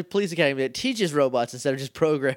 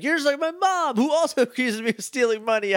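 put-on much higher whiny teen girl voice; mock offended [name]; 8 semitones higher than usual. [name] snapping back at Tommy, high mock-whiny teen. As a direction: toss it back like a wounded teenager.

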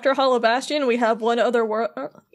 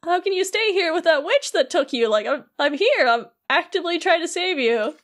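higher-pitched, hurt protest; mock-upset Beast; 6 semitones higher than usual. Beast protesting to Belle that he came to save her, mock upset. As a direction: plead as a wounded Beast, pitched up. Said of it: "Beast is getting all sad".

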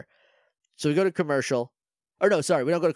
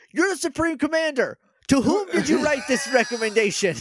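high-pitched indignant challenge; mock-furious scolding; exasperated outrage; 8 semitones higher than usual. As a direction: demand an answer in comic disbelief. Indignant rant at the bird character.

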